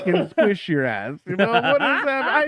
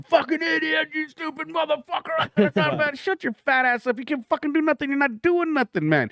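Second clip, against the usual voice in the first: high-pitched hammed-up armchair rant; yelling sports fan; 13 semitones higher than usual. Fan berating players on television, mock angry yelling. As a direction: rail at them in a high, strained voice. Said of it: "you were yelling at somebody else".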